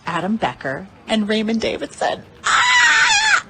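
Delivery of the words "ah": high, loud scream of joy; joyful shout. Real, not acted. Thrilled winner screaming to her viewers with joy. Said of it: "shaking with joy".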